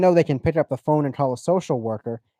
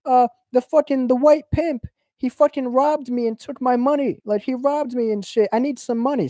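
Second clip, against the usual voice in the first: put-on much higher, pleading girlish voice; mock pleading woman; 10 semitones higher than usual. A woman begs her pimp Goldie, high and whining. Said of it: "So she's like".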